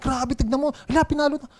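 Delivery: high, breathless gossip about the fight; wife excitedly pointing out the fight. Retold or imitated. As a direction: mimic the excited wife at the window. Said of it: "Sobrang excited niya".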